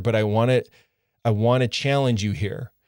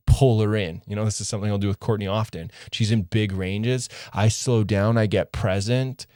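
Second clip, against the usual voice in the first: ordinary talk, nothing marked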